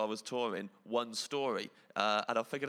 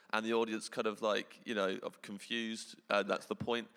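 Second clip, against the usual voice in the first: ordinary delivery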